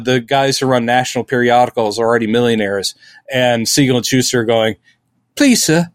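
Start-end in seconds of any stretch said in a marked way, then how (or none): 5.3-5.9 high, pleading British orphan voice, slowed on the middle line; hammed-up pleading British urchin; British beggar. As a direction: beg for more like a Dickens orphan in British accent. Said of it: "I don't know why they're British".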